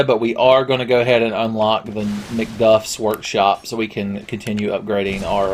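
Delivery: usual speaking voice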